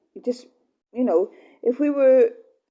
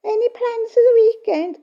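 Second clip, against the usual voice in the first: high prying chirpy voice, louder; nosy neighbour; 12 semitones higher, 5 dB louder than usual. Retold or imitated. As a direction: mimic a busybody asking about your plans. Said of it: "it was things like Elizabeth saying".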